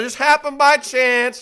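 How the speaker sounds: sing-song fake storytelling; breezy made-up story. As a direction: sell the coincidence like a fibber. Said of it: "gonna start out like this".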